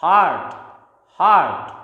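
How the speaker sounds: "heart" with no R sound; silent R. Shown as a demonstration. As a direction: say "heart" like "haat". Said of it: "not hurt". ("heart" में R silent)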